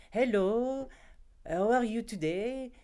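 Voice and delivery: put-on high, loud English in a thick French accent; little girl putting on a heavy French accent for classmates. A schoolgirl at a Swiss lycée greets classmates in a thick French accent. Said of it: "speaking the English with a French accent".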